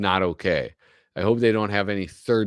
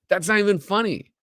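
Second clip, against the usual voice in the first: incredulous high; 12 semitones higher, 3 dB louder than usual. Real, not acted. Incredulous at comic.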